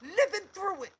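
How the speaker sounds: loud, high emphatic cry; real outraged yell. Real, not acted. Woman insisting she went through it all, outraged yell.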